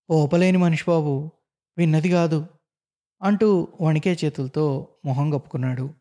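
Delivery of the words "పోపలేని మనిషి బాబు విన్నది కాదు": ordinary delivery, nothing marked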